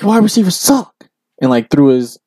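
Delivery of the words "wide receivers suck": imitating coach yelling. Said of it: "he's like".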